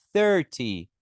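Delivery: "Thirty" stress first syllable, falling end; thirty pronounced. Shown as a demonstration. Teacher demos "Thirty" for students, falling tune. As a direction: model "Thirty" clearly. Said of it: "veja que tem uma diferença".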